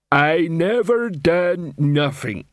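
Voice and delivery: slow, dull troll voice. A narrator's reading. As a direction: drag it out like a sullen troll. Troll Coalface speaking.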